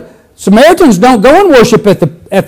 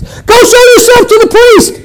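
much higher, joyful shout; 12 semitones higher than usual. Shown as a demonstration. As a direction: shout it far down the road. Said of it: "Jesus just yelled back to him".

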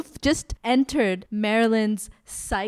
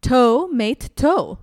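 slow pitch title; 4 semitones higher, 8 dB louder, much slower than usual. Pretend. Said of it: "Give me one fruit or vegetable Lorena Page pitch".

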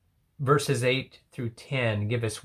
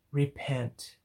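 soft repeat; 7 dB quieter than usual. Real, not acted. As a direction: let it land softly. Repeats the call softly.

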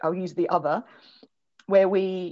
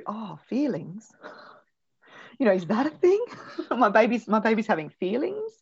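higher, laughing mock realization; surprised mum; 5 semitones higher than usual. Mum realizing her crying baby has feelings, amused surprise with laughs. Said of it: "for mums to go".

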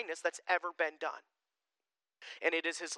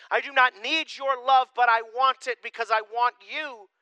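higher, louder pleading as God; impassioned plea; 7 semitones higher, 7 dB louder than usual. Acted. Pastor voicing God to his people, louder, higher pleading.

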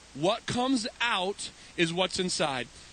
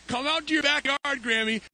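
higher voice, calling to grandma; retold calling out; 5 semitones higher than usual. Grandson phones grandma to come outside, raised calling voice. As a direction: re-enact summoning someone outside.

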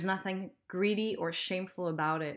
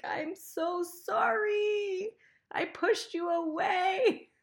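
much higher, slow, tender apology; 12 semitones higher, slower than usual. Pretend. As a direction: coo it like hugging a returning puppy. Apologizing to money like a dog.

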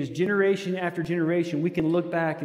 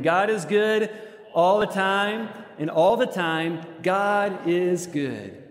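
congregation refrain; 3 semitones higher, slower than usual. Shown as a demonstration. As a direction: lift it as a shared declaration. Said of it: "as we say this together again".

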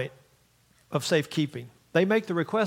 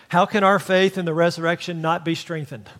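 usual speaking voice

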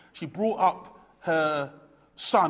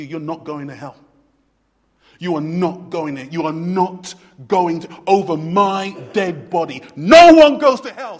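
building louder to a shout; Christ's insistence; 11 dB louder than usual. Retold or imitated. Jesus refusing to let sinners go to hell, rising to a shout. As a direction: insist harder and harder. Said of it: "Christ will say to you".

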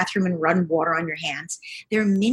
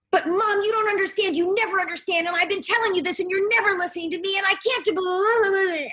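put-on much higher, whiny complaining kid voice; upset teenage daughter; 14 semitones higher than usual. Daughter unloading on mom in a high whiny voice. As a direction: play the twelve-year-old who feels unheard. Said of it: "your child's gonna say".

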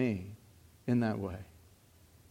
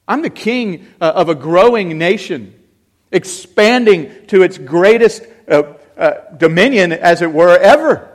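rising, louder royal boast; loud proud king; 6 semitones higher, 14 dB louder than usual. Acted. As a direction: proclaim it like a king. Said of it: "David would say".

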